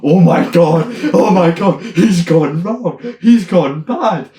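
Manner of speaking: high, loud, slow panicked exclamation; alarmed. Retold or imitated. Friend retells panicking at the entertainer, high and loud. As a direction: gasp it out in mock horror. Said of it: "I'm like".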